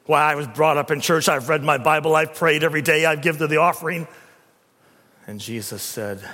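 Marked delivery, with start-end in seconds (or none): 0.1-4.1 louder, rattling off his good deeds; a lightly voiced proud Pharisee; boasting Pharisee. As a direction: brag and rush the list.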